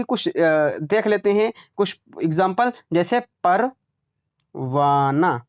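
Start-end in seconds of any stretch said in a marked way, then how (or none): 3.4-5.4 Parwana drawn out very slowly, ending in a plain long आ with no ह; slow model word. Shown as a demonstration. Teacher modelling the silent h for students, very slowly. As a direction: stretch Parwana syllable by syllable for the class. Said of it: "हमने नहीं पढ़ा ना".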